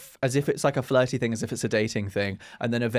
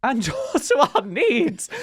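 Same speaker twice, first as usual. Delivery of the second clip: laughing; 10 semitones higher, 4 dB louder than usual. Acted. Mocking repeat of co-host.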